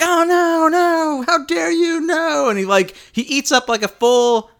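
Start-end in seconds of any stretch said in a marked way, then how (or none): 0.0-2.5 mock panic. Mocking Death Eaters again.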